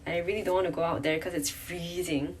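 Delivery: ordinary delivery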